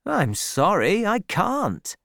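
lightly voiced sergeant, mildly apologetic; a hint of a soldier's excuse; soldier refusing. A narrator's reading. Troy refuses Fanny, voiced.

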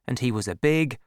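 usual speaking voice

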